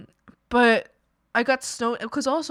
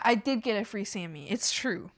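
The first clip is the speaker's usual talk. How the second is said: usual speaking voice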